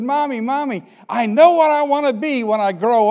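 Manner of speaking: lightly voiced eager five-year-old; excited little girl. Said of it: "she said".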